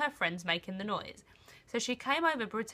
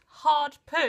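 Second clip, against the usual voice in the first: loud punchline; 6 semitones higher, 9 dB louder than usual. Retold or imitated. Reading embarrassing search history.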